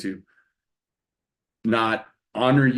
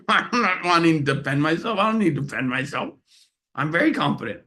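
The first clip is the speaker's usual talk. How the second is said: lightly voiced, higher defensive protest; husband mockingly defending himself to his wife; mock defensive; 6 semitones higher than usual. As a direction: play it as a half-joking self-defence. Retelling his defensive reply.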